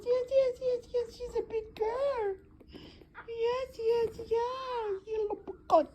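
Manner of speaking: very high, slow doting coo. Real, not acted. Cooing at the kitten.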